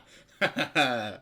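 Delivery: loud burst of laughter. Real, not acted. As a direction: crack up loudly. Reacting to the story line.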